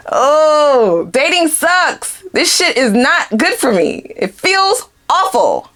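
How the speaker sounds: high, drawn-out mock wailing; mock lament. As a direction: whine it like a hammed-up heartbroken dater. Voices the ex lamenting dating.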